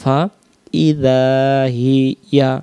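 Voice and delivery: ফাইযা said slowly with its hamza, then হিয়া; careful faidha hiya. Shown as a demonstration. Teacher warns students, careful slow ফাইযা. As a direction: stretch ফাইযা slowly, hamza kept, not ফালিজা. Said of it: "সাবধান".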